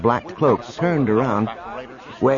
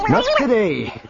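higher and firm; 12 semitones higher than usual. Acted. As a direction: shut it down firmly. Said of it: "Wedge repeated firmly".

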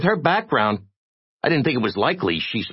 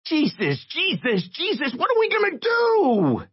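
very high squeal, building in panic; panicked little voice. A narrator's reading. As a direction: wail it higher and higher in panic. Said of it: "irritating little voice in a panic".

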